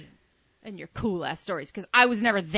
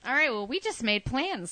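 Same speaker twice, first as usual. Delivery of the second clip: normal talking voice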